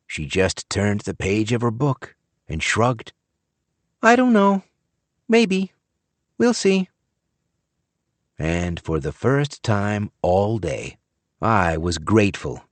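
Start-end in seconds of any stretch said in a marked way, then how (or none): 4.0-6.9 put-on small high girl, noncommittal; a shrugging kid who doesn't care; child's voice. A narrator's reading. Child shrugging off a job offer from Jerry.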